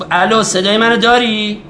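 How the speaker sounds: بلندتر roepen: "صدای منو داری؟" with a lift on منو; calling out on the phone. Acted. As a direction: raise the voice into a weak phone line. Calling into a bad phone line.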